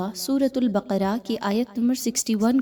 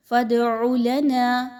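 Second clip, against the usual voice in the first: slow chanted فَادْعُ لَنَا, louder; Quranic recitation; 3 semitones higher, 4 dB louder, slower than usual. Acted. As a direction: intone the phrase like a reciter. Reciting the next part.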